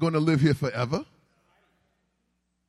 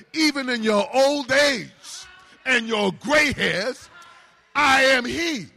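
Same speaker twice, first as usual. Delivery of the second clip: loud, higher, building preacher's cry; full force preaching; 5 semitones higher, 4 dB louder than usual. Real, not acted. Pastor rallying the congregation against the devil, loud and high. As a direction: raise the roof with it.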